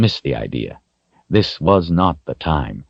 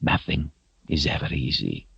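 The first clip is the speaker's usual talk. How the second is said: soft sigh; 9 dB quieter than usual. A narrator's reading. Zed comforting.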